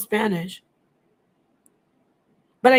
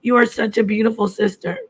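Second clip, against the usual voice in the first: usual speaking voice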